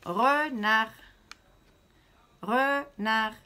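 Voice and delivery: Renard scandé fort, re-nard en deux coups; syllabes frappées. Shown as a demonstration. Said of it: "frapper deux fois dans la main". (maîtresse qui scande Renard en deux frappes)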